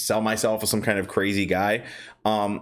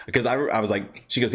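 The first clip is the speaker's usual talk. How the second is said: normal talking voice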